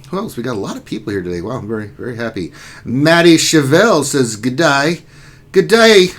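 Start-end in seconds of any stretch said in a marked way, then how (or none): 5.5-6.1 put-on Australian accent. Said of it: "That's an Australian [name], mate".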